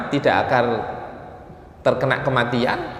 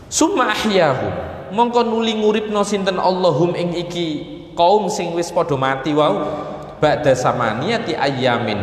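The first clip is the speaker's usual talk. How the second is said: raised, measured scripture-reading voice; kitab recitation; 5 semitones higher than usual. Acted. Preacher reading tafsir to his listeners, kitab recitation. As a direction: read the Arabic out with its Javanese gloss, pitched up. Reads Arabic text with Javanese gloss.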